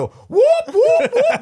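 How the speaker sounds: very high, loud whoops; imitated jubilant whooping; gleeful whooping. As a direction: whoop like someone jumping for joy. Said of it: "står och hoppar och bara".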